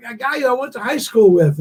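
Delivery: ordinary delivery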